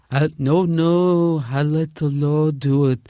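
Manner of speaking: slow, drawn-out dramatic actor impression; ponderous movie heavy, every word stretched; Rod Steiger. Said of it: "only comic still doing Rod Steiger".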